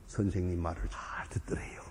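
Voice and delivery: hushed confiding whisper. Real, not acted. Preacher confiding the story's end, whisper. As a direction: let it drop to a whisper.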